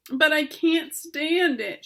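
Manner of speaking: high, slow, anguished shout. A narrator's reading. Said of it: "shouted Wilbur".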